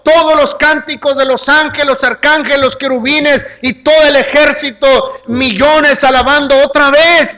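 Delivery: high, loud, exultant proclamation; full-force preaching. Acted. Preacher picturing heaven's armies praising the Son's return, exultant preaching. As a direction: build a celebration at the top of the voice, drawing out key words.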